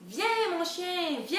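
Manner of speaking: high, drawn-out call; calling a dog. Acted. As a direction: call the dog over eagerly. Girl calls her dog in story.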